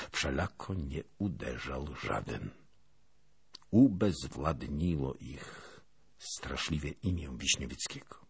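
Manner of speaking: ordinary delivery, nothing marked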